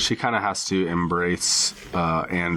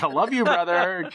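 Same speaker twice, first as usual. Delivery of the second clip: higher, tender mock love; doting son voice; 10 semitones higher than usual. Son kissing the mannequin goodnight, higher and cooing. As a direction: coo it to the doll. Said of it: "He's like".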